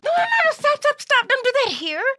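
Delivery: high, loud urgent no; alarmed shout. Acted. Host stopping the puppet bouncing around the station, alarmed shout. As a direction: stop the puppet in a hurry.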